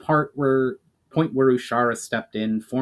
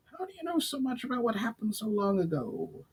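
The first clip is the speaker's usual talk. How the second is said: higher, softer goblin asking in wonder; 11 semitones higher, 9 dB quieter than usual. Acted. Goblin astonished at the elf's knowledge, soft higher voice. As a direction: ask it gently, high and hushed.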